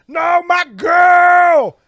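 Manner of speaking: high, drawn-out wail of a shout; hammed-up cry for his girl; mock anguished shout. Boy calling out for his girl, hammed-up high shout.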